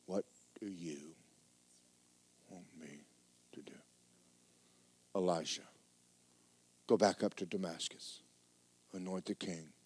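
very soft, low, near silent; barely above breath, as the still voice; hushed. Acted. Elijah and God speaking in a still small voice.

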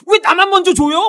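loud, hammed-up bratty protest; child's tantrum. Child protesting to parents over a gift, petulant. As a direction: burst out like an ungrateful child. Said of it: "이러는 자녀는 이상한 자녀잖아요".

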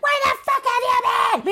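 high, shrill-free accusing aunt voice; aunt confronting the niece back late, high mock anger; mock angry aunt. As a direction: demand it like a furious aunt. Voicing Aunt Bet accusing.

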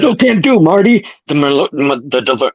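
put-on high, loud excited old scientist; wild-eyed inventor shouting; Doc Brown voice. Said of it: "trying to channel the spirit of Christopher Lloyd".

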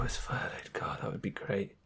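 a soft, unvoiced wish under the breath; whispered aside. Real, not acted. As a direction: whisper it to yourself, dreamily. Wistful aside about being furloughed.

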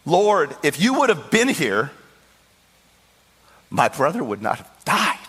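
high, loud anguished cry to the Lord; loud wailing cry. Retold or imitated. Mary wails at Jesus' feet, loud anguished cry. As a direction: cry it out, grief spilling over. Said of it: "She didn't say it quietly".

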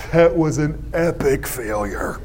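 deliberate, lingering delivery; mock announcement. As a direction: stretch it out like a grand pronouncement. Acts encourager, contrasted with next.

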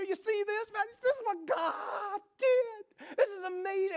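emotional, gushing. Retold or imitated. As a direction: overflow with grateful wonder.

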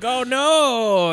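high, loud, drawn-out mock alarm; mock dismay. Drivers finding her lying in the road, mock dismay. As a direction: exclaim it in exaggerated concern.

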